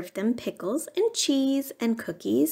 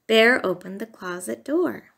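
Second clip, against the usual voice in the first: ordinary delivery, nothing marked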